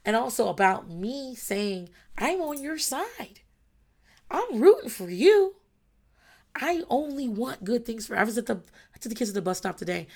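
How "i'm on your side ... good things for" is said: slow, high-pitched cheering; cheerleading parent. Acted. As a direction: draw it out high, cheering them on. Acted cheerleading to her kids.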